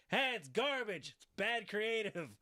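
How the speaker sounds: high, slow mock holler; exaggerated revelling. Host overdoing the wrestler's verdict, mock revelling. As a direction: relish it like a hammed-up heckler. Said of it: "He didn't revel in it that much".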